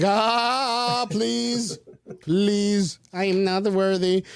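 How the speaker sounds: high, pleading mock plea; begging the aliens, half-laughing; pleading abductee. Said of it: "It's like".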